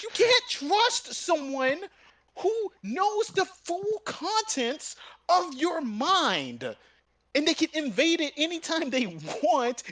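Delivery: high, slow, deliberate spelling-out; slow it down, lecturing; slow, high lecturing reply; slow emphatic retort. Retold or imitated.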